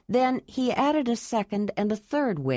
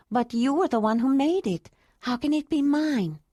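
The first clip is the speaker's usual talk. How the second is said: woman's voice; 6 semitones higher than usual. A narrator's reading. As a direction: answer gently as the woman. Ayla wondering.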